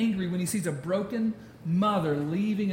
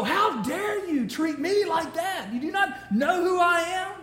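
high, slow mock-haughty huff; offended ego; 10 semitones higher, 4 dB louder, slower than usual. Preacher mimicking an inflated ego, high mock indignation. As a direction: puff yourself up, hammed-up wounded pride.

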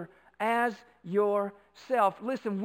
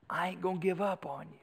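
softer, understated promise; quiet vow; 3 semitones lower, 7 dB quieter than usual. Acted. Preacher restating what love is, quiet and resolute. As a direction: bring it down to a hushed resolve.

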